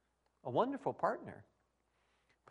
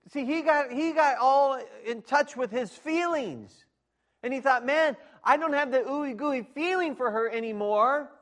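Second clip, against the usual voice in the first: higher and loud, mocking the self-focus; full-force preaching; 9 semitones higher, 11 dB louder than usual. Pastor mocking man's feelings to congregation, loud preaching. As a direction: preach it big, ridiculing feelings. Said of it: "preaching at full force".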